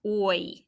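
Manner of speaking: "oi" gliding from o to i; diphthong sound. Shown as a demonstration. Teacher demonstrating the diphthong to students, gliding. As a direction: slide from "o" into "i". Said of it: "When it goes".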